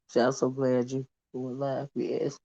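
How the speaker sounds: voix basse, presque chuchotée; drops to a near-whisper for Mia; hushed aside. Real, not acted. Greeting a viewer softly.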